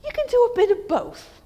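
high, wheedling temptress voice; coaxing Jezebel. Retold or imitated. Preacher voicing Jezebel coaxing Ahab to worship Baal, high wheedling voice. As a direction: sweet-talk him into both gods. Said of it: "She said".

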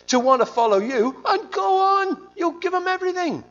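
high, loud, exasperated complaint to God; whining Jonah. Retold or imitated. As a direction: rant at God like a sulking prophet.

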